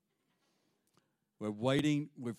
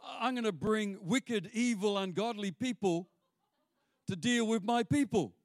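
higher, fast announcing voice; God announcing an amazing plan, higher and brisk; 9 semitones higher than usual. Retold or imitated. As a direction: proclaim it like big good news. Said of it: "the Lord says".

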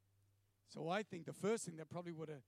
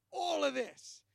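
loud, raised, insistent declaration; emphatic demand; 6 semitones higher, 9 dB louder, slower than usual. Retold or imitated. Man shoveling all his wants on God, insistent. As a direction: punch ALL high and loud.

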